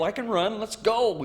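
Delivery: high, pressed call to move; urgent urging. Retold or imitated.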